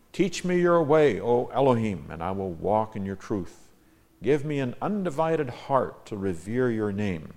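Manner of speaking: declaimed psalm, key words lifted; measured, emphatic recitation; emphatic scripture reading. Acted. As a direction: read the verse out as a prayer to God. Reads own psalm translation.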